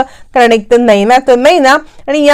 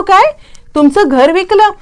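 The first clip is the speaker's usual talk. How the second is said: shocked question; 7 semitones higher than usual. Retold or imitated. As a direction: pitch jumps up on काय, startled disbelief. Shocked at news of sold house.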